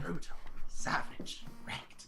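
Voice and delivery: whispered heckle, drawn out; hushed, teasing commentary; mock whisper. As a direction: tease in a slow stage whisper. Whispered heckle at failed accent.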